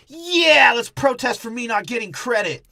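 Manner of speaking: loud, high indignant yell; mock protest rallying. Guy retelling a movie as a protester demanding credit, indignant yell. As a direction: holler it like a one-man protest.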